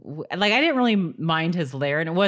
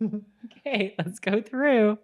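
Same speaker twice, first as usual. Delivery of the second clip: high, slow bracing himself; hesitant mock resolve, drawn out; imitating a character; 6 semitones higher, much slower than usual. Co-host imitating him about to dive through, hesitant. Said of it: "funny how he's like".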